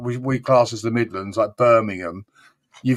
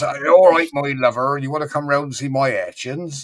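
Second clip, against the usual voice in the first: put-on West Country accent. Mocking the southwest accent for American friends. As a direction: ham up a West Country charmer. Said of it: "they all sound like what you would class as pirates".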